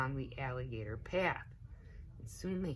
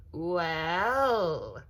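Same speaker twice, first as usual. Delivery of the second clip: loud drawn-out menacing well; sly big alligator; 4 semitones higher, 8 dB louder than usual. A narrator's reading. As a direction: savour it like a predator. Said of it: "said the big, hungry alligator".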